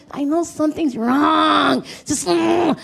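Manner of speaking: drawn-out queasy complaint; acted-out discomfort, slow and straining; mock frustration. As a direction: stretch it out into a frustrated groan.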